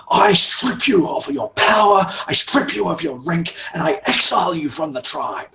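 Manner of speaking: furious chief shouting his verdict; shouted banishment. Acted. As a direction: bellow the sentence of exile. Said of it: "yelling at the top of his lungs".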